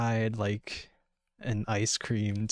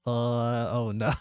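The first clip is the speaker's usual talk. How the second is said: ordinary talk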